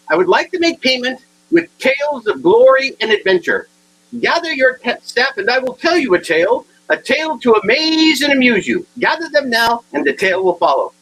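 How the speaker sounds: mock-epic flourish; grand storyteller. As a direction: announce it like a tale-spinning bard. Grandly offers tales as payment.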